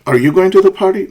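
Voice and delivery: quick climb toward "party"; rising question. Shown as a demonstration. Teacher repeats a yes-no question, rising intonation. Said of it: "It's a yes, no question".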